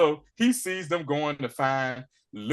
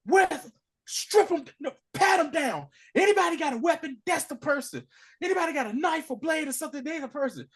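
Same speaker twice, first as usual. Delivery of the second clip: going nuts; 13 semitones higher, faster than usual. Retold or imitated. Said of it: "Luca is going nuts".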